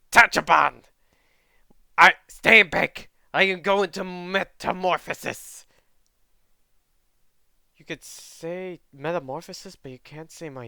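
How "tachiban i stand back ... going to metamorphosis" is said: put-on loud, bellowing wild-boy yell; hammed-up battle cry, much louder; Inosuke shout. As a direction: charge in roaring like a brash fighter. Voicing Inosuke charging in.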